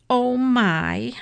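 high, drawn-out mild dismay; storybook worried exclamation. A narrator's reading. Baby dismayed at sister's outburst.

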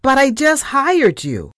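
high, loud burst of disbelief; shrieked. A narrator's reading. Startled boss protests the new assistant's demand, shrieking. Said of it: "I shrieked".

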